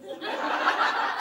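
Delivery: breathy voiceless laughter; real laugh. Real, not acted. Preacher laughs after retelling his farewell, amused. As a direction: laugh at your own story.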